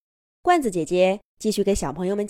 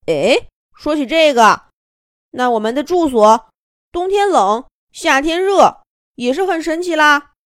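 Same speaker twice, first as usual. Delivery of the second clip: marveling little bird; 7 semitones higher, 7 dB louder than usual. Acted.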